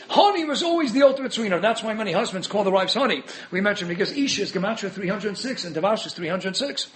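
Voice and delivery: normal talking voice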